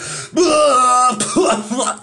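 high, hacking mock cough-laugh; imitated coughing fit. Old man choking on a toke, mock coughing fit. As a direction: act out choking on the smoke. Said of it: "and he's like".

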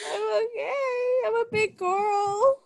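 hammed-up whimpering, teasing her friend; mock crying little girl.

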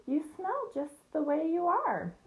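high, slow jeering lamb; drawn-out, high-pitched taunt; scornful lamb. A narrator's reading. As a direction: sneer at the pig in a lamb's high voice. Lamb jeers at the pig.

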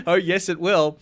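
high, loud laughing taunt; oh yes it will, laughing; laughing tease. Real, not acted. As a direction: gloat it through laughter.

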